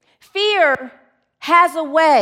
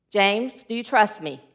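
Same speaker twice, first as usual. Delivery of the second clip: lightly voiced God, lower and calm; booming voice; 6 semitones lower, 4 dB quieter than usual. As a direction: ask gently from above. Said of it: "It's a booming voice".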